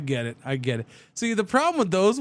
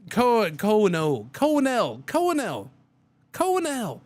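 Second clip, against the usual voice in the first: "Colonel" said again and again, climbing higher each time; heavy accent; 6 semitones higher than usual. Shown as a demonstration. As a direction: stretch "Colonel" into a thick mock accent, going up. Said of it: "with a heavy accent and quickly".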